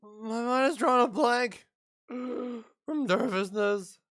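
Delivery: put-on a shy girl losing her words; nervous girl voice. Voicing nervous game girl.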